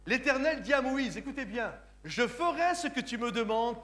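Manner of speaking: normal talking voice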